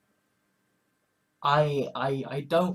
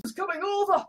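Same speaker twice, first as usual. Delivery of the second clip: excited; 13 semitones higher, faster than usual. Real, not acted. Excited about coming change.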